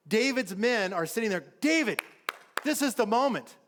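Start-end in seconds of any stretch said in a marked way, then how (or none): 1.6-3.6 high, pressing appeal to a leader; urgent urging. Acted. David's men urge him to seize the moment, urgent.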